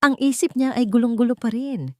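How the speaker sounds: ordinary delivery, nothing marked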